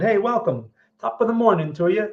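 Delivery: a hint of a jokey Irish accent. Trader greeting Northern Ireland viewer, mild mock Irish accent. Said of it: "It's my Irish accent".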